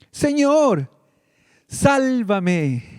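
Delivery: a sinking man's cry, pitched high; Peter crying out. Acted. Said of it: "Entonces gritó".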